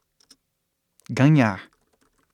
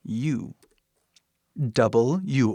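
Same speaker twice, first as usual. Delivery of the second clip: ordinary delivery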